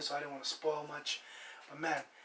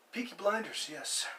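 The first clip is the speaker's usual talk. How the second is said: ordinary delivery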